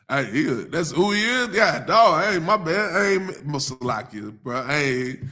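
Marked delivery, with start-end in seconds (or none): none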